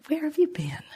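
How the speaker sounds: high, soft whispered question. Acted. Someone greeting their arriving wish, soft high whisper.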